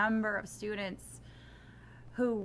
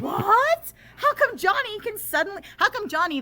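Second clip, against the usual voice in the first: much higher and louder, an incredulous squeal; mock disbelief; 12 semitones higher, 9 dB louder than usual. Teacher acting baffled that the poor reader understood, mock disbelief. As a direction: ham up a baffled teacher's shock.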